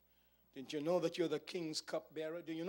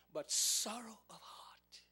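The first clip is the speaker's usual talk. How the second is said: slow whispered verdict; 4 semitones higher, slower than usual. Acted. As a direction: breathe the words out, hushed. King's quote ends hushed.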